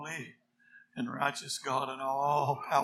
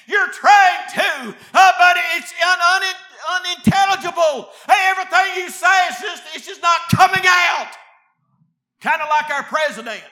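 raised, driving revival preacher's delivery; full-force preaching; 12 semitones higher, 14 dB louder than usual. Real, not acted. Preacher warning sinners they cannot plead, full-force preaching. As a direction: preach it hard at the congregation.

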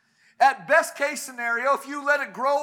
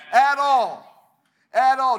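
louder, hammered repeat; insist, louder each time; emphatic preaching; 7 dB louder, slower than usual. Acted. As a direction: press it home to the congregation. Preacher pressing home no shame.

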